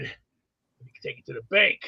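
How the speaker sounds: low, hushed quote of a wrestling villain; Million Dollar Man. Acted. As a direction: drop low and let it sink to near silence. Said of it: "As the Million Dollar Man once said".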